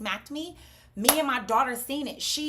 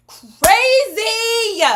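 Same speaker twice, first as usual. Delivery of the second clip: high, loud drawn-out cry; big exasperated shout; incredulous exclamation; 12 semitones higher, 15 dB louder than usual. Real, not acted. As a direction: belt it out in disbelief. Exclaims at the whole story.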